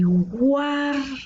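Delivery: "Guárdamelo" with a high, long GUAR, then da-me-lo; stressed syllable shown. Shown as a demonstration. Teacher pointing out the tonic syllable, high stretched GUAR in "Guárdamelo". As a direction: lift and hold GUAR in "Guárdamelo". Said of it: "La sílaba tónica está aquí".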